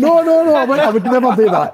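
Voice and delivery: high, loud playful denial; mock protest. Host expects him to tell him off, comedian loudly protests. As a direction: overdo the protest.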